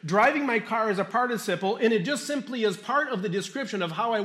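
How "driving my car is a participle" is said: ordinary delivery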